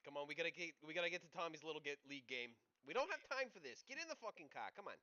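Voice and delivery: loud, high New York yell; New York parent yelling at the kid; New York mom yelling. Acted. Same New York parent hurrying.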